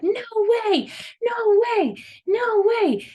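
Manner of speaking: high, loud screaming no way; a kid's shrill protest, loud and high; child screaming. Retold or imitated. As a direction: scream it like a toddler melting down. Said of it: "And he's, like, screaming".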